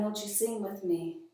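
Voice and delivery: ordinary talk, nothing marked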